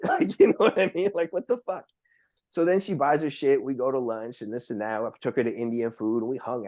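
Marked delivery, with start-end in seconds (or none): none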